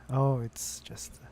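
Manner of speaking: ordinary talk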